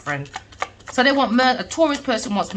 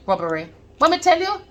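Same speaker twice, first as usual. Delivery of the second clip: ordinary talk, nothing marked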